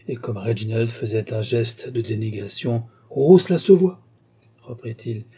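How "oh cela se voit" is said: higher, louder flattering protest; effusive exclamation. A narrator's reading. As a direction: brush the modesty aside effusively. Bookseller flatters his new customer. (exclamation flatteuse, haute et forte)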